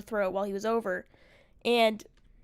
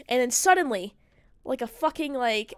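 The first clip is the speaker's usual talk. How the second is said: normal talking voice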